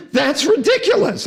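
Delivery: incredulous. Real, not acted. Outraged remark on the law.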